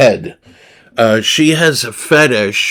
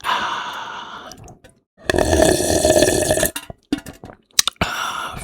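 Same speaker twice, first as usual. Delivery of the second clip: ASMR sigh; 8 semitones lower, 8 dB quieter than usual. Shown as a demonstration. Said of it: "A M S R lemonade".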